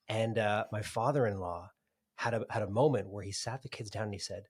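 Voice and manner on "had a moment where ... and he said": normal talking voice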